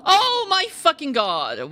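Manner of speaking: high, loud and slow, stretched out in horror; drawn-out horrified yell; shocked cry. Real, not acted. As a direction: let the shock burst out. Shocked at the closet.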